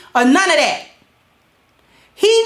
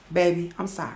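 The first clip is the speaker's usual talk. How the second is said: much lower, much softer rehearsed apology; practice apology; 9 semitones lower, 10 dB quieter, faster than usual. Shown as a demonstration. Rehearsing an apology to husband in the mirror, hushed and low. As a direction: murmur a trial sorry to the mirror. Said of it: "practicing how I'ma say sorry".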